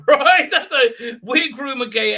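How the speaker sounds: laughing. Real, not acted. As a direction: laugh out a mocking question. Laughing at weird elf.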